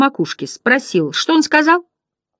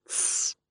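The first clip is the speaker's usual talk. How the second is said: sharp whispered 'tsss'; hissed shush; 16 dB quieter than usual. A narrator's reading. Other butterflies silencing their neighbour, hissed shush. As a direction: hush them with a long hiss. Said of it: "зашипели остальные".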